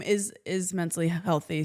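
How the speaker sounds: ordinary talk, nothing marked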